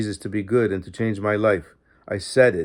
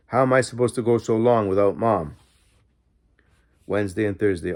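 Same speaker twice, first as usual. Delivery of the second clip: ordinary delivery, nothing marked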